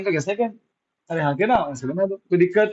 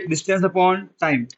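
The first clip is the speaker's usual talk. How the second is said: slow dictation; much slower than usual. Shown as a demonstration. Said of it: "teacher dictating formula".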